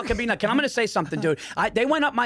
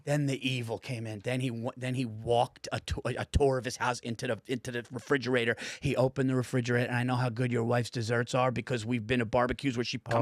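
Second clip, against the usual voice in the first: low, soft ominous telling; mock sinister hush; hushed storytelling; 7 semitones lower, 7 dB quieter than usual. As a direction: drop the voice like a spooky tale. Hushed build-up of funny betrayal.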